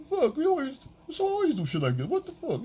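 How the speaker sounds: high, exasperated whine; mock whiny boyfriend. Rejected boyfriend complaining in bed, high and whiny. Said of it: "You're like".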